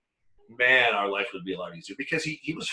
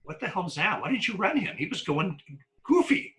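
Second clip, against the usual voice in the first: partners' disbelief; 8 semitones higher than usual. Retold or imitated. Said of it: "my partners come up and they go".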